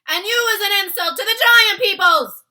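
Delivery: very loud, high giant shout; furious shout. A narrator's reading. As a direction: bellow the insult. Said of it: "shouted the blood bottler".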